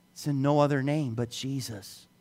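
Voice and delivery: normal talking voice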